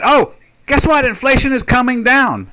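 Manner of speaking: put-on excited announcer imitation; mock good-news announcement. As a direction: announce it like big news, mockingly. Mimics media announcing good news.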